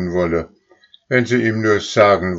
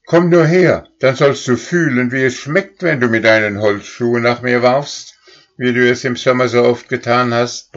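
much higher, loud angry threat; bellowing cow; 6 semitones higher, 5 dB louder than usual. A narrator's reading. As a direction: roar out the old grudge. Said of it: "brüllte Stern".

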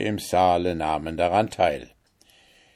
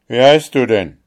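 loud, higher-pitched puzzled question; astonished; 4 semitones higher, 9 dB louder than usual. Acted. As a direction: ask it in amazement, louder. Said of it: "fragte der Kaiser verwundert".

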